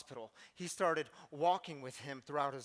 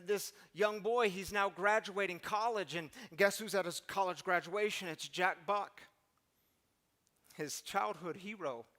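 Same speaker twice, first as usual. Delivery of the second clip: ordinary talk, nothing marked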